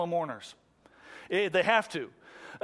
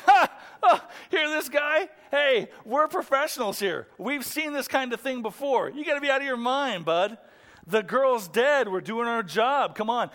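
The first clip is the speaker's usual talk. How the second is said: higher-pitched scoff at a fool; mocking laughter, jeering; 4 semitones higher than usual. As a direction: act out incredulous professionals brushing him off. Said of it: "mourning turns into laughter right away".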